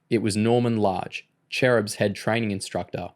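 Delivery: ordinary delivery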